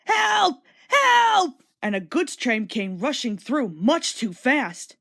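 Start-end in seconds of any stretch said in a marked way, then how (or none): 0.1-1.6 high, loud desperate whistling cry; panicked cry for help. A narrator's reading. Runaway engine James screaming for help to anyone. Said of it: "he heard an engine whistling".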